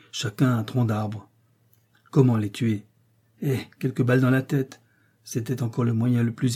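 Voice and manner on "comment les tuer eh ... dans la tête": ordinary delivery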